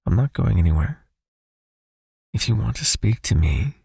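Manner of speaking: normal talking voice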